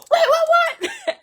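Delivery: very high, loud, stretched with laughter; delighted disbelief. Retold or imitated. Husband cannot believe the good news, squealing. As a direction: squeal it in happy shock. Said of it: "He was like".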